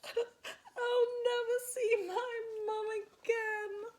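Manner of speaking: high, slow, tearful wail. A narrator's reading. Lost Spinderella fears losing her mum, slow high wail. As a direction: draw it out in a hammed-up cry. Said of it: "she wailed".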